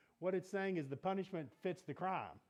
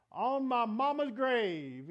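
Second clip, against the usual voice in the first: slow, high, louder mock oath; swearing an oath; 7 semitones higher, 9 dB louder, much slower than usual. Preacher mimics someone swearing to prove sincerity, mock oath. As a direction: ham up a solemn street-style swear. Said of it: "You don't need to say something like".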